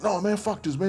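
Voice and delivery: high, agitated retold outburst; pissed off. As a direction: imitate an antsy friend fed up with waiting. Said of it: "and he's pissed".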